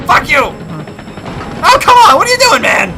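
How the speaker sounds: loud, high outraged yelling; furious shouts as the enemy kills him; angry shout. Real, not acted. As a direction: yell at the enemy in frustration. Enemy catches him, he dies.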